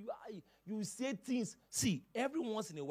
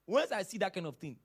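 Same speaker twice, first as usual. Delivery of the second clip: ordinary talk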